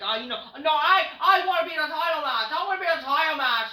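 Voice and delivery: put-on high, loud whiny kid voice; mock her like a bratty child; mock bratty whine, high and loud; whiny child mockery. Mocking Rhea as a child.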